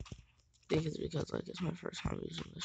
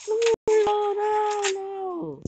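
much higher, louder frantic no's; high panicked pleading; 13 dB louder, much slower than usual. Real, not acted. Gamer pleads with the enemy player, high and panicked. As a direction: squeal in panic as you're about to die. Begging enemy not to kill him.

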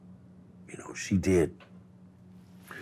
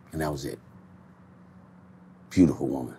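ordinary talk, nothing marked

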